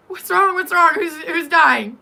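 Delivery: loud, high panicked cry; acted alarm. Babysitter running upstairs to her screams, alarmed shout. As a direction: burst in shouting as if someone is dying.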